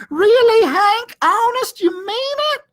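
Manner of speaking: very high, loud puppy squeal; little dog can't believe his luck; overjoyed. Acted. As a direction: burst out with giddy hope. Drover jumping with sudden joy.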